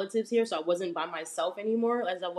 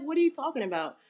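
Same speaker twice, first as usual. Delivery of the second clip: higher, bewildered question; puzzled surprise; 7 semitones higher, faster than usual. Retold or imitated. Retelling her baffled reply to Aunt Martha, surprised. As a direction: ask it like the request makes no sense. Confused reply to aunt's instruction.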